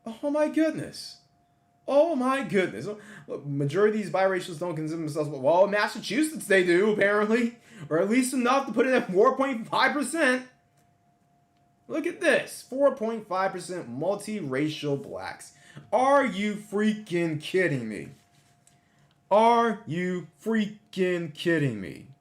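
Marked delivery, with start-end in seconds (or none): 0.1-2.9 amazed. Real, not acted. Reacting to statistics. 15.9-22.1 slow, high, disbelieving; incredulous. Real, not acted. Reacting to statistics.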